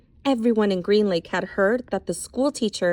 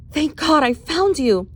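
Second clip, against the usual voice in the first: relieved sigh; 6 semitones higher, 4 dB louder than usual. Acted. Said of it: "she sighed".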